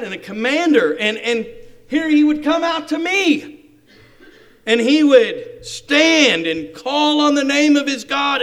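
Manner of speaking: high, loud indignant outburst; furious Naaman. Acted. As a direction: proclaim the grand plan with wounded pride. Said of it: "furious, and went away and said".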